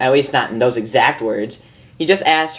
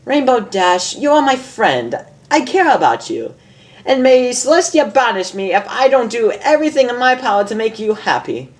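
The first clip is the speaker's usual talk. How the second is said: much higher, amused lady unicorn voice; a refined mare teasing her friend, higher; Rarity; 10 semitones higher than usual. Acted. Reads Rarity's lines in voice.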